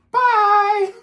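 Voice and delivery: very high, loud farewell; bright sign-off; cheery bye. Real, not acted. Signing off to kids.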